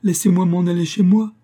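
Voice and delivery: high loud pleading cry; girl crying out to be let go. Acted. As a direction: beg to go home, crying out. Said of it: "criait Proserpine".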